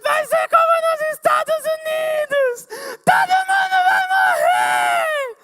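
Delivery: high, loud, drawn-out panicked wail; hysterical shout. Retold or imitated. Panicking woman wailing to the room, hysterical slow shout. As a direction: shriek it as if the world is ending.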